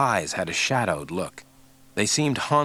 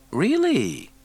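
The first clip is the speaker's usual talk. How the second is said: teasing; 11 semitones higher, 3 dB louder than usual. A narrator's reading. Mulder teasing.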